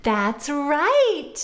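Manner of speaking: usual speaking voice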